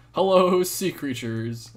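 hello called out loud and high; hello, belted out to the sea creatures; a loud sing-song hello. Shown as a demonstration. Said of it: "Hello, or".

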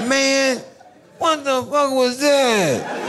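high, slow, laughing disbelief; can't believe what he just did, high pitched; incredulous high. Retold or imitated. Asking Al what that was, high and incredulous. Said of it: "I was like".

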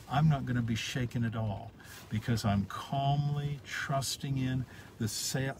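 normal talking voice

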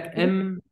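"إم" held as one separate beat; isolated syllable. Shown as a demonstration. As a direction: sound out "إم" alone, then stop. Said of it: "ما أقول لك".

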